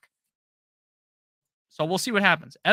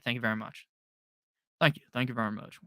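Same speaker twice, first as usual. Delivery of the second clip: a lightly voiced, lower [name] thank-you; [name] impression; 4 semitones lower, 5 dB quieter than usual. Streamer thanking a donor, in a hint of [name]. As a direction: croon a lazy, lightly done [name]. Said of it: "That was my nice [name] impression".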